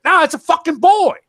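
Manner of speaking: angry shout. Real, not acted. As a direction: blast it out in outrage. Heated rant about gender.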